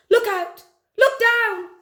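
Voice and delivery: loud, high warning cry; yelling. A narrator's reading. Drip warning the sisters on the bridge, high loud yell. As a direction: yell each warning sharply. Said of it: "who yelled".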